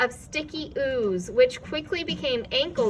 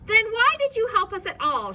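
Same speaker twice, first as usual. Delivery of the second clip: much higher, loud angry question; angry shout; 11 semitones higher, 6 dB louder than usual. A narrator's reading. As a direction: demand an answer. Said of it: "shouted Milo angrily".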